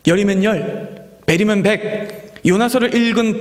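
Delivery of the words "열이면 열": usual speaking voice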